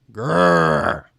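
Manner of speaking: low grrr growl; little growl. A narrator's reading. Narrator demonstrating frustration, a low growl. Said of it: "Like this".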